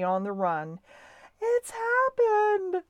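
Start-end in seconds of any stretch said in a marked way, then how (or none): 1.4-2.8 high, drawn-out wail of alarm; distressed cry. A narrator's reading. Missus Little finding Stuart's things at mouse hole, distressed cry. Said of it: "she cried".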